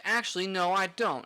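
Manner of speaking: mock rude customer; rude snap. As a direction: brush him off, rude and loud. Customer's rude reply, retold.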